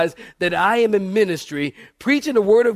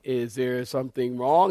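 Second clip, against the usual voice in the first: low, slow, softer question; hushed, low-pitched wondering aside; puzzled aside; 9 semitones lower, 6 dB quieter than usual. Retold or imitated. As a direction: ask it slowly under your voice, baffled. Puzzled aside to wife, acted out.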